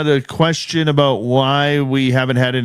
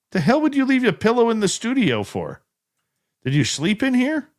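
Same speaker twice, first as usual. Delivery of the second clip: higher, baffled disbelief; incredulous; 10 semitones higher, 3 dB quieter than usual. Real, not acted. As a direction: react like that makes no sense. Baffled at a viewer's pillow.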